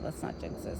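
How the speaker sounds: whispered aside. Real, not acted. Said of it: "I don't wanna jinx it".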